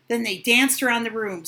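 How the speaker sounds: usual speaking voice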